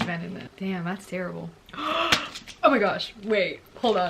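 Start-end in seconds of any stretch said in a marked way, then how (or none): 1.5-2.6 sudden shocked gasp; sharp gasp. Real, not acted. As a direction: gasp in real surprise. Shocked reading reaction.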